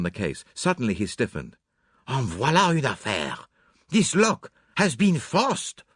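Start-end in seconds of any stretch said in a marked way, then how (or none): 2.1-5.8 shocked discovery, rising high; Poirot. Acted. Poirot discovers the forced lock, shocked exclamation. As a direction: exclaim it slowly, aghast.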